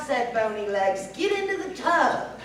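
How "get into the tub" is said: witch voice. Acted. Witch orders Sasha.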